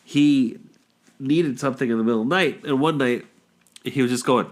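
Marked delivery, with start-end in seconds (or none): none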